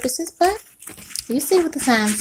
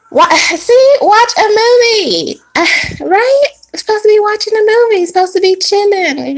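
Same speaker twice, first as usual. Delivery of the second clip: much louder and higher, shouting it out; excited shout; 9 semitones higher, 5 dB louder than usual. Real, not acted. The reader telling viewers to relax, shouting excitedly. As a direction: hype the viewers up loudly. Excited rant about movie night.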